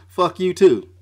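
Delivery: a sudden loud curse; loud outburst. Real, not acted. Ranter cursing out listeners who disagree, in a loud outburst. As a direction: blurt it out loud at them.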